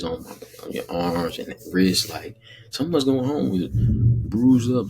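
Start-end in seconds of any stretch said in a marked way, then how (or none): 2.7-4.9 worked-up emphasis. Real, not acted. Emphatic rant about rough play.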